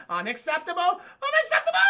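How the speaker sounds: high, rising, loud shriek; screeching Lemon Grab outburst; Lemon Grab impression. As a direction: scream it like Lemon Grab losing it. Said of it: "He reminds me of Lemon Grab".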